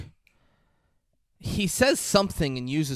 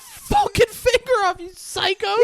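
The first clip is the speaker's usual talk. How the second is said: high screaming agony; pained scream; 6 dB louder than usual. Retold or imitated. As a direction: scream through the pain. Man screaming after finger cut.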